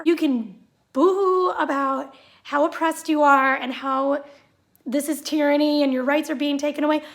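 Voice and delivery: ordinary delivery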